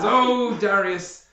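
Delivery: high, slow mocking praise; sarcastic noble. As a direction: lay the fake flattery on thick while laughing. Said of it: "He says".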